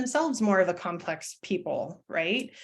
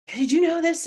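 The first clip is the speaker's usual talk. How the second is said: high, louder excited quote; eager retold question; 9 semitones higher, 5 dB louder than usual. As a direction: pitch it up like sharing fun trivia. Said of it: "if I'm like".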